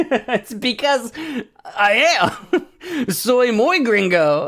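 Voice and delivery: high, slow laughing admission; laughing gringo admission. Real, not acted. Streamer answers chat, laughing at his own accent. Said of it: "You sound so gringo?".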